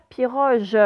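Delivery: "piroge" ending in a French "j" sound; soft G. Shown as a demonstration. Teacher showing pupils the misspelling's sound, soft G in "piroge". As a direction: soften the last consonant of "piroge" to zh. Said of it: "si je mets un E directement".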